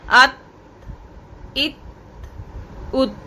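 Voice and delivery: अत, इत, उत each ending in a light breath; soft breath release. Shown as a demonstration. Teacher demonstrating soft breathy endings. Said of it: "इसको कहा जाएगा हम्स".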